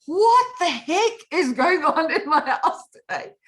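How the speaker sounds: high, loud shocked exclamation; jolted out of bed, shouting; startled outcry. Acted. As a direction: cry out in alarm, laughter creeping in. Retelling her startled jump from bed.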